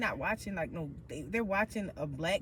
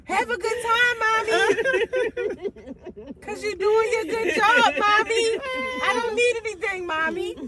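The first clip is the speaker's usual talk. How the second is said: put-on high, loud child cheering mommy on; sweet kid voice; 10 semitones higher, 10 dB louder than usual. Kids telling tired mom she's doing great, high and loud. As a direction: chirp it up like a proud little kid.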